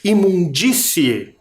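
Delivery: Imundície slowly, with a forceful DÍ; strong stressed DÍ. Shown as a demonstration. Teacher showing a stronger accent, Imundície slow with stressed DÍ. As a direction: push the DÍ of Imundície strongly out of the mouth. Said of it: "parece que sai forte pela boca".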